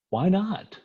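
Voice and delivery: high-pitched incredulous question; baffled. Retold or imitated. Doctor pressing the surgeon, high and baffled. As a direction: ask it like the excuse makes no sense.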